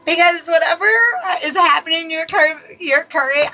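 high, giggly delivery through real laughter; laughing. Real, not acted. Card reader tickled while answering whether Tammy gets the job, laughing. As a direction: try to get the words out while laughing. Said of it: "I don't know why I'm laughing".

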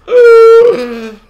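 high, loud strangled cough-cry; choked cry. Real, not acted. Player killed again, strangled high cry. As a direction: choke out a cry as you die. Dying in game.

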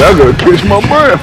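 high, loud mocking imitation; mocking quote. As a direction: squeal it like a mocking replay. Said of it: "He said".

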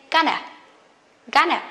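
"gonna" as one quick reduced word; short, quick "gonna" for going to; quick reduced form. Shown as a demonstration. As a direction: toss off "gonna" in two short syllables. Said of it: "very quick".

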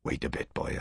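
breathy murmur, almost voiceless. A narrator's reading. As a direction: murmur it under your breath. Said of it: "I murmured".

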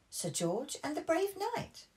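high loud call-out; high childish call-out; eager interruption. Acted. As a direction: interrupt with excitement. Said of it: "Peppa interrupted again".

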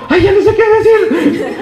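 high, laughing, flustered little cry. Retold or imitated. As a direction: laugh it out, caught off guard and embarrassed. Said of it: "la pobre Paola hasta se asustó y dijo".

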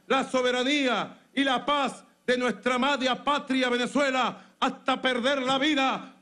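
usual speaking voice

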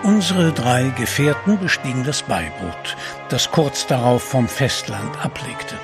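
usual speaking voice

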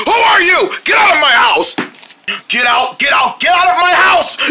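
panicked shout at an intruder; yelling. Acted. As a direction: yell them out of the house.

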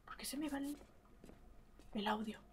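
soft unvoiced whisper. Real, not acted. Player puzzled by the audio dropping, whispering. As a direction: ask it under your breath. Wondering about audio issue.

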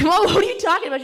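high, fast, loud protest to mom; exasperated. Acted. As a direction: act out an incredulous daughter. Acted exasperated reply to mom.

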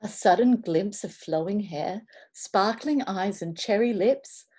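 ordinary delivery, nothing marked

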